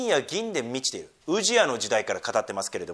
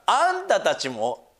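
slipping into Osaka dialect; 5 semitones higher, 4 dB louder, much slower than usual. Real, not acted. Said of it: "大阪弁っぽくなっちゃった".

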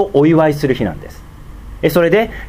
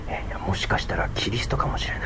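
breathy murmured whisper, almost no voice; hushed whisper; 8 semitones lower, 13 dB quieter than usual. Acted. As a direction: whisper it like gossip in the crowd. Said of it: "ひそひそと". (ひそひそ声でささやく)

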